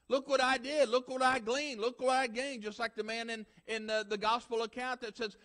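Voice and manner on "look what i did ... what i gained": mock bragger, raised and proud; boastful churchgoer. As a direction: puff up like a smug rich man.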